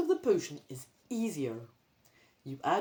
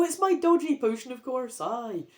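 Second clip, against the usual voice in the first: ordinary delivery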